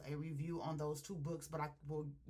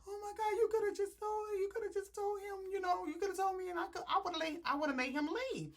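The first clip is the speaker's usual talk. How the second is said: high, fast mock-tearful pleading; crying; 13 semitones higher, 3 dB louder, faster than usual. Woman pleading with the shooter she knows, crying. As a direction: imitate a woman babbling through sobs. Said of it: "So she's still crying like".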